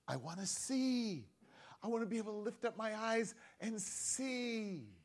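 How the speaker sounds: hushed, yearning high voice; earnest longing. Acted. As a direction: wish for it tenderly, drawing out the last word. Blind man voiced pleading his wish.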